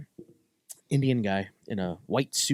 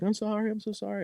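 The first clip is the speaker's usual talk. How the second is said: mimicked crying apology; 11 semitones higher, 4 dB quieter than usual. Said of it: "the poor girl was, like, crying".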